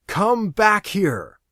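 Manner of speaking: loud, high and slow; desperate shout. Acted. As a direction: yell after her to come back. Aaron shouts after Lori.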